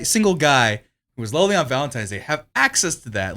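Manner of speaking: normal talking voice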